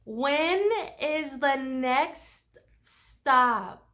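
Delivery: condescending "oh no, honey", then a very slow loud repeat; patronizing tourist spelling the question out slowly; hammed-up American tourist talking down to the driver; mock entitled tourist. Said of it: "They're like".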